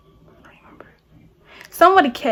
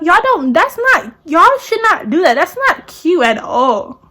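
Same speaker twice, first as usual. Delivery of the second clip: higher and louder, scolding; heated scolding; 6 semitones higher, 5 dB louder, slower than usual. Real, not acted. Vlogger scolds viewers who fake deaths, raised heated voice. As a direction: wag a finger at the audience.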